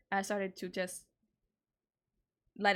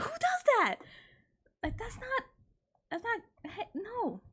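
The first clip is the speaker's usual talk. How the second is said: exasperated squeal; 13 semitones higher than usual. Real, not acted. Ranting to viewers about his reaction, exasperated high squeal. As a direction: screech the question in disbelief.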